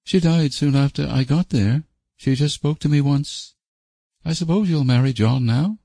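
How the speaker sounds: high, tired character voice; weary bereaved woman. Acted. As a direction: recount the death wearily. Voices Janet, weary after death.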